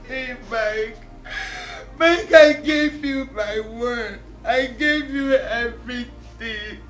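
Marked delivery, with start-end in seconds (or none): 2.0-6.8 tearful wail swelling into loud cries; crying plea. Acted. Betrayed woman asking Mike why, crying plea. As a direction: cry out in betrayed anguish.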